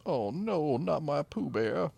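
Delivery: high, slow mock wail; mock grief. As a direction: wail over the lost dog.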